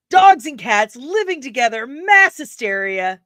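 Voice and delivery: loud cry of doom, stretched at the end; loud outburst. Acted. Streamer acting a favorite film line, loud outburst. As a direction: proclaim it loudly, dragging out the last words.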